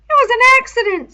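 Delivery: high, loud, stubborn insistence. A narrator's reading. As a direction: dig your heels in on every word. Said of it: "said Piggy stubbornly".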